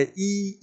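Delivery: long drawn-out ई; demonstrated vowel. Shown as a demonstration. Teacher showing where the tongue touches, demonstrating long ई. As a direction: hold ई as a long "ee", softly. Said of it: "जिससे चिपकती है".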